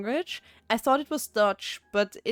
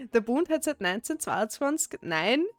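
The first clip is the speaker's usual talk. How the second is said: amused Austrian dialect reading. Shown as a demonstration. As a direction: list the states in broad Austrian dialect. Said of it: "That's how people speak around me".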